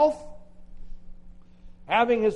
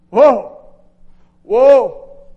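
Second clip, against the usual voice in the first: "Woe" cried out much louder, drawn out; anguished cry; 3 semitones higher, 18 dB louder than usual. Acted. Isaiah crying woe before the Lord, loud lament. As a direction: wail it like a broken man.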